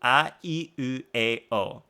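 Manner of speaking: a, i, u, e, o as separate pure vowels; Japanese vowel sounds. Shown as a demonstration. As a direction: recite a, i, u, e, o one by one, low and even. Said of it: "only vowel sounds in Japanese".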